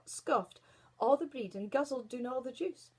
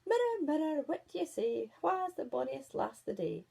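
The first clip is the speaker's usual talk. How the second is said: high, louder chanting queen; Queen's sing-song question; 5 semitones higher, 4 dB louder than usual. Acted. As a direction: call grandly to the mirror. Queen asks her mirror.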